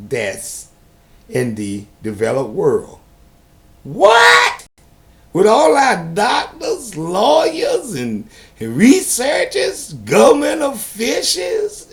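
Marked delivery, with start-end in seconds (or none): 3.8-4.7 high squealing disbelief; shocked what. Real, not acted. Reacting to the maternal death rate, shocked high outcry. 5.3-11.9 indignant shout. Real, not acted. Indignant listing of experts.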